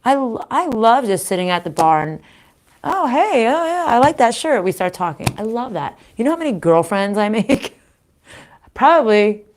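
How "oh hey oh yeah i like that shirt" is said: high-pitched friendly bar greeting; acted perky small talk; chatty greeting. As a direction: greet a stranger eagerly, pitched up.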